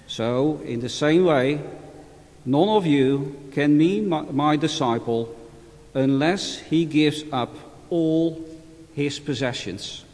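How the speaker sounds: measured solemn scripture reading; Gospel reading. Acted. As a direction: read it like a priest at Mass. Formal Gospel reading at Mass.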